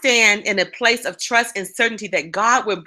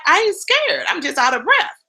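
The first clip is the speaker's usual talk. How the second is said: defensive retort; 7 semitones higher than usual. Retold or imitated. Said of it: "And I'm like".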